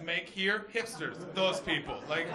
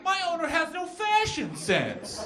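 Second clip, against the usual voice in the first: a higher silly voice for the jeans; a bit higher, mock-snooty butt voice; talking butt; 9 semitones higher, 5 dB louder than usual. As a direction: give the backside a huffy little voice. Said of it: "their ass is going like".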